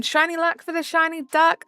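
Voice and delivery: ordinary delivery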